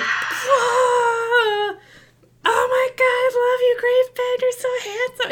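squealing fangirl shriek, very high; adoring crowd. Acted. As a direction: swoon over the champion. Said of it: "And everyone's like".

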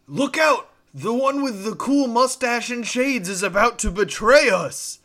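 high, booming shouted warning; super loud yell. Acted. Caster warns the gnoll crowd of a traitor, super loud yell. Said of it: "make myself yell super loud".

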